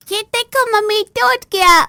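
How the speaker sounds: put-on very high, loud gleeful child voice; naughty child. Little girl showing mother the broken glass, high gleeful child voice. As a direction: crow like a brat who broke it.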